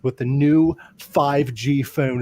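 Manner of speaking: spelled out slowly; feed the line slowly and clearly; slow prompting read; slow coached line. Shown as a demonstration. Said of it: "all you gotta say is".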